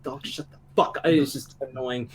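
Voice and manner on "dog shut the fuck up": muttered shush. Retold or imitated. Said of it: "it was just like".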